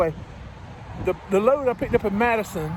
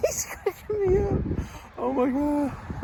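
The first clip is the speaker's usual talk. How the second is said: high, slow words squeezed out while laughing; can't stop laughing; cracking up; 6 semitones higher, 3 dB quieter, slower than usual. Real, not acted. Trucker cracking up over the angry agent, slow giggly voice.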